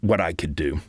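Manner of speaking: ordinary talk, nothing marked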